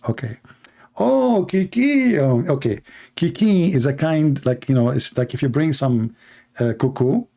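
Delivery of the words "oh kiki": surprised. Real, not acted. Reading a name, amused.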